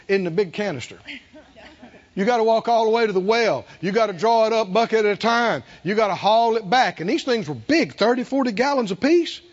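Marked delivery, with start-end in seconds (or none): none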